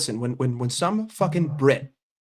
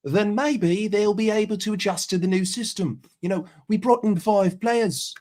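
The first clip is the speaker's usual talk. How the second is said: a higher, hammed-up Aussie accent, mate; mock Aussie accent; 4 semitones higher than usual. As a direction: play the chummy Aussie bloke telling fans to be patient. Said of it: "there's the fucking Aussie".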